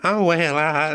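hammed-up country woman. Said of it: "an old Southern woman".

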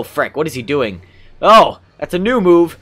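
ordinary delivery

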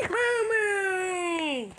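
high, squealed happy cow moo; long high pretend cow cheer; excited moo. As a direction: gasp and moo with delight. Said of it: "You must be excited".

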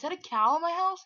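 loud, high, fast question; real surprised outburst. Real, not acted. Gamer finding a cow in the house, loud surprised question. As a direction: blurt it out loudly in disbelief.

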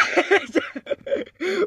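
high, breathy burst of laughter; real surprised laugh; startled laugh. Real, not acted. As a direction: laugh in disbelief. Reacts to odd game head.

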